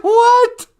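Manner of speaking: high, loud astonished outburst; retold disbelief. Host relives learning that cats cry tears, astonished. Retelling her shocked reaction.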